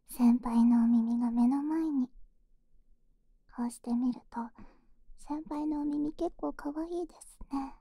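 soft close whisper; right in your ear. Acted. Whispering during ear massage.

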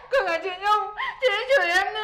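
fake crying. Tattling while crying.

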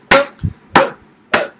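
ordinary delivery

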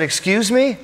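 sharp, high indignant question; indignant excuse me. Retold or imitated. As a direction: bristle as if offended. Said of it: "and she said".

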